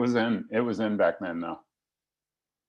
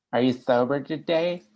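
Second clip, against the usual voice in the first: higher, sing-song parody of the syrupy "Are you sober today?"; mocking sweet AA voice; 6 semitones higher, 4 dB louder than usual. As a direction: lay on the syrupy AA tone to make fun of it. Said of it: "that's how you people talk when I'm new".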